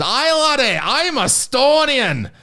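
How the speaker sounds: high, hammed-up Scottish brogue, slow at the end; exaggerated Scottish accent, pitched high; mock Scottish accent. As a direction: play a comic faux-Scot in a Glasgow club. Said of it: "he comes up and says".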